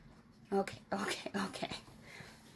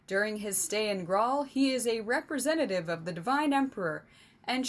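royal speech; 3 semitones higher, 3 dB louder, faster than usual. Acted. King announcing the emissary to the crowd, grand and forced. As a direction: speak to the masses through a forced smile.

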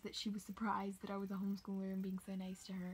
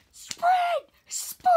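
full-throated scream, much higher and louder; screaming coach; 19 dB louder than usual. Retold or imitated. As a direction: bellow it at the runners like a coach. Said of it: "screaming at the top of his lungs".

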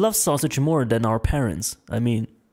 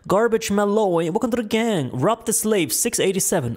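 usual speaking voice

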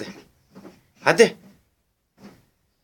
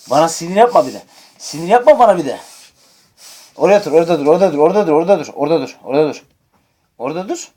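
mock scolding; 8 dB louder, faster than usual. Real, not acted. Scolding toddler: stay there.

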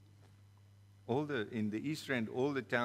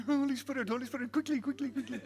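high, loud mocking woman's voice; a jeering woman, pitched high and loud; 14 semitones higher, 3 dB louder, faster than usual. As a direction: taunt him in a high, loud voice. Woman taunting him.

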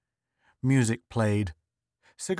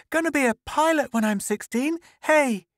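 put-on very high, boastful child's voice; excited little boy; 3 dB louder than usual. A narrator's reading. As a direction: announce it like a thrilled young son. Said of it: "His son ran in".

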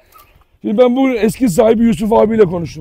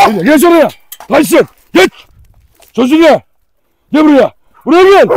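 loud, high shouted orders; shouted commands; 7 semitones higher, 7 dB louder than usual. Real, not acted. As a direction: shout orders at an unruly dog. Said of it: "Bağıra bağıra artık sesim boğazım acıyor". (bağırarak emir verme)

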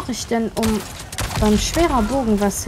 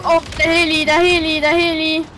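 higher, louder urgent call; excited callout; 6 semitones higher, 3 dB louder than usual. Real, not acted. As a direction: shout about the heli. Spotting helicopter, calling out.

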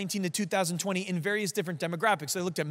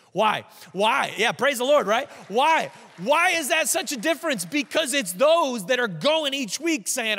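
loud, driving sermon peak; fiery preaching; 5 semitones higher, 7 dB louder than usual. Acted. Pastor to congregation, loud and driving. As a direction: preach it out to the whole room.

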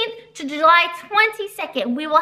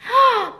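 a sharp, high delighted gasp; excited gasp; 6 semitones higher than usual. Acted. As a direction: gasp as if the book thrills you. Gasp over prizes for kids.

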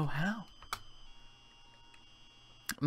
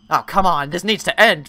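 ordinary talk

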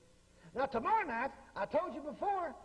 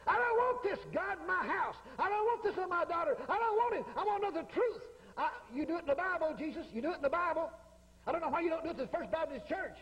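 higher-pitched, re-enacted plea to God; insistent prayer; 5 semitones higher than usual. Father praying to Jesus about his daughter, insistent. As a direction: protest to Jesus, pitched up and emphatic.

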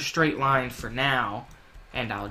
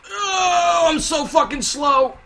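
panicked scream; 10 dB louder, slower than usual. Real, not acted. Panicked boss chase, screaming.

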